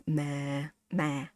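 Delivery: "ma" said low and soft, pitch kept down. Shown as a demonstration. Teacher to viewers, modelling the low "ma" tone. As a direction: keep "ma" very low in the voice. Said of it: "Keep it very low".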